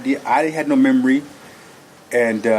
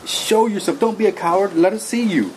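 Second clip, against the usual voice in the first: retold scream; 7 semitones higher than usual. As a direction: taunt it as a coward in a mock yell. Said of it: "screaming at the top of my voice".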